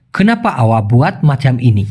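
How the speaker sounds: very slow, each word separate; Malay sentence said very slowly; slow Malay demo. Shown as a demonstration. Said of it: "Malaysian ফরম্যাটে হয়".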